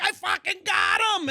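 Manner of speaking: high, drawn-out excited yell; loud imitation of a worked-up friend; retold scream. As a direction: holler back as the frantic angler. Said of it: "he's screaming at me".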